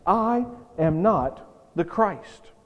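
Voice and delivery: slow, each word set apart, "I am not the Christ"; measured, emphatic denial with weight on "not". Acted. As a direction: deliberately separate and stress "I", "not" and "Christ". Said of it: "He's emphatic about this".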